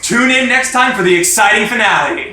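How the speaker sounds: big serial-finale announcement; announcer sign-off. Pretend. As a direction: belt out a hammed-up show outro. Mock announcer sign-off of episode.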